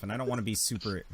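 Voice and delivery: ordinary talk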